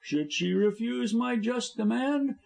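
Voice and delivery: high contemptuous sneer; sneering villain. A narrator's reading. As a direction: sneer down at them. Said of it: "sneered Busham".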